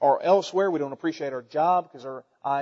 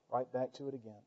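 "Right back to it again" dropped much softer; quiet repeat; 5 semitones lower, 14 dB quieter than usual. Real, not acted. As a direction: let the echo fall away softly.

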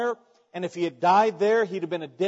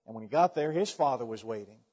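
ordinary delivery

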